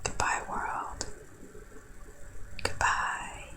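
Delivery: slow, soft dramatic whisper; whispered goodbye. Pretend. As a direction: fade out like a dying hero. Mock farewell while freezing.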